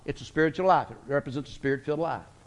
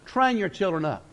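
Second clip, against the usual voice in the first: ordinary talk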